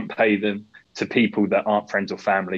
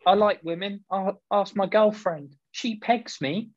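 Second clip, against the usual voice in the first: whiny voice; 10 semitones higher than usual. Retold or imitated. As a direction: play a pathetic excuse-maker. Mocking defensive man's excuses.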